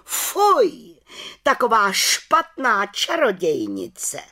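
high, slow, outraged witch voice; scandalised outcry. A narrator's reading. Chief witch appalled before the council, scandalised cry. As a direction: cry out in loud indignation. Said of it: "najednou pohoršeně vykřikla".

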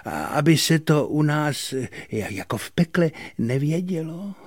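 high-pitched, halting complaint; hesitant excuse turning into reproach; reproachful little devil. A narrator's reading. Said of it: "zabručel vyčítavě".

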